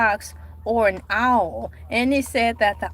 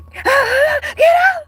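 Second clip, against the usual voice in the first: movie-mother shout; 13 semitones higher, 7 dB louder than usual. Retold or imitated. Re-enacting a film mother throwing someone out, screaming. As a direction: scream it at the top of the voice. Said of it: "[name]'s mama from the scene".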